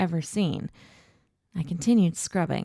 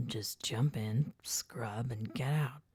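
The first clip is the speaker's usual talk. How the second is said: slow, soft, whispered advice; hushed; 4 semitones lower, 11 dB quieter, slower than usual. A narrator's reading. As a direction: murmur it slowly.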